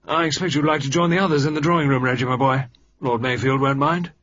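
put-on hearty old officer nudging the boy out; Sir George voice. Sir George sending young Reggie off, bluff and hearty.

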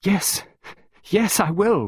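put-on high, tearful child giving in; crying. A narrator's reading. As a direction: cry out agreement. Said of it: "Pippa cried".

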